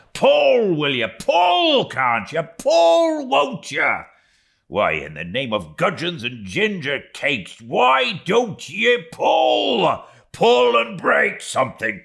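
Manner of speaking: high, loud, half-joking bellow at the oarsmen; mock-furious boat-header shouting pull; Stubb rallying his rowers. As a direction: roar them on with fury spiced with fun. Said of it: "a tone so strangely compounded of fun and fury".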